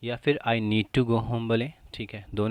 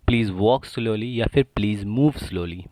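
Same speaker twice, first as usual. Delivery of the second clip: ordinary delivery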